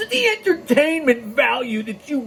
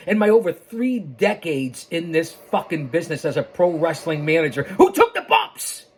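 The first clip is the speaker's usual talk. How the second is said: ordinary delivery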